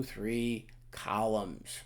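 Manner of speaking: three columns drawn out slow with a playful twang; little twang. Shown as a demonstration. Teacher drawling three columns with a twang. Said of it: "I like saying it like that ... little twang".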